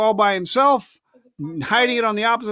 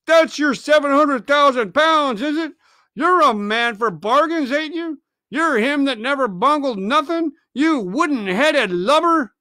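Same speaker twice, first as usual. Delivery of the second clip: angry pirate; 6 semitones higher than usual. Acted. Mutineer sneering at Silver over the treasure. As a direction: jeer at him like a mutineer. Merry taunting Silver, voiced.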